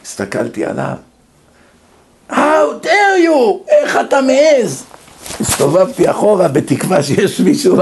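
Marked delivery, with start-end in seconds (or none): 2.3-4.8 high, drawn-out mock outrage; outraged judge. Judge scolding man with newspaper, acted outrage. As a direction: thunder it like an offended judge, hammed up.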